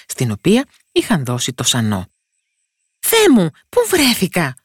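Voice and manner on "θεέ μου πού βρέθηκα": high, loud little cry; tiny character's shocked outburst; startled exclamation. A narrator's reading. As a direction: exclaim in sudden alarm, up high. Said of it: "αναφώνησε ο μικρούλης".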